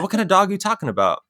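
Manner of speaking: high-pitched, quick baffled question; incredulous question. Retold or imitated. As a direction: retell firing back a puzzled question, pitched right up. Questions mom about the sudden dog.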